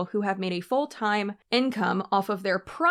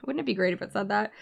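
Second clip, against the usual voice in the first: ordinary delivery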